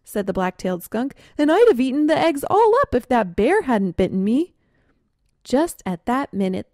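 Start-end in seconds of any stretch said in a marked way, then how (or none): 1.4-4.5 higher and louder, indignant; indignant claim. Acted. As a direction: insist you were first. Skunks arguing over nest.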